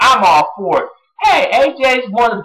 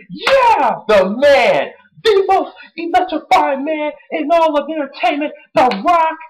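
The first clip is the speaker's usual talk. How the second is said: announcer; 9 semitones higher than usual. Retold or imitated. Mimics wrestling announcer intro.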